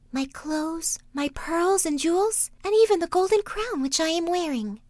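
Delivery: princess voice. Acted. Princess answering the frog: high voice. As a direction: lift the voice up high for the young princess. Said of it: "said she".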